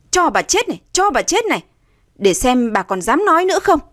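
high-pitched vicious outburst; uncontrolled anger. A narrator's reading. Stepdaughter hitting her stepmother, enraged. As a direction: lash out with every phrase. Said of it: "Nga tức không kiềm chế nổi".

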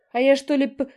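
high, loud hurt squeak; offended squeak. A narrator's reading. Younger sister defending herself, offended squeak. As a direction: squeak out your innocence. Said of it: "обиженно пискнула она".

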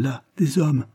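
a frightened stammer, voice lifted high; terrified young woman stammering; stammering in fright. A narrator's reading. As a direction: blurt it out, scared and halting. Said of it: "balbutia-t-elle".

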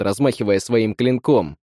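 plain reading voice

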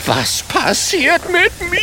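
very high panicked squeal; shrieking. A narrator's reading. The shrinking wizard cries out in panic, very high. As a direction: shriek in alarm as you shrink. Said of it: "kreischt der Zauberer".

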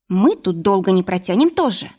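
ordinary delivery

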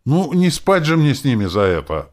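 high, loud outburst; indignant exclamation. A narrator's reading. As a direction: burst out in protest. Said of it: "воскликнула она".

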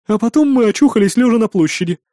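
high, loud character voice; excitable hostess storytelling; innkeeper telling events. A narrator's reading. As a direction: carry on an animated account. Innkeeper continues her tale.

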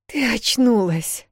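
high, slow, sighing with relief; relieved. A narrator's reading. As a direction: breathe it out in relief. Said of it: "С таким облегчением выдохнула Вэл".

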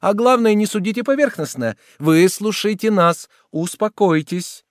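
high, insistent entreaty; urgent pleading. A narrator's reading. Woman urging the soldier to listen, urgent pleading.

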